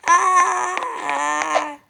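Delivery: long, slow pained yell; stretched mock scream; drawn-out shout. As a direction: howl it out as you're hit. Kid cries out when hit.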